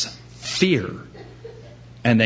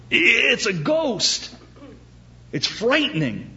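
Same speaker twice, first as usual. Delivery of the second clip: high, slow mock terror; hammed-up frightened moan; slow spooked gasp; 10 semitones higher than usual. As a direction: stretch it out in comic fear. Boss says don't take coat off.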